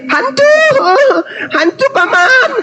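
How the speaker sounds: high, wailing cries for help; hammed-up panic at seeing a ghost; mock terrified shouting. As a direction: scream it like a scared disciple, playacting. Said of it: "melihatnya dan berteriak".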